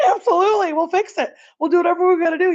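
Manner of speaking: high, eager voice through a big smile; imitating gushing eagerness. Acting out the teacher accepting the broken trucks, high and gushing. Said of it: "He was, like, smiling so big".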